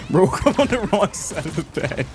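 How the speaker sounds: high, breaking up into laughs while talking; cracking up mid-sentence; real laughter. Real, not acted. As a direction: try to talk through a laughing fit. Cracks up at chatter's excuse.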